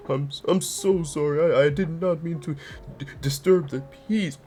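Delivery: mock him as a guilty, upset man begging forgiveness; guilty whiny apology voice. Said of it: "I don't know why I gave him that voice".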